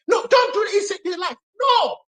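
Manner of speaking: high-pitched, agitated plea; re-enacted alarmed objection; urgent warning. Retold warning from a doubting relative.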